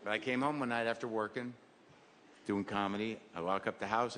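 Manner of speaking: usual speaking voice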